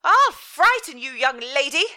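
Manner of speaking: high, loud furious mother; furious threat. Acted. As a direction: spit the threat at her daughter. Furious mother threatens her daughter.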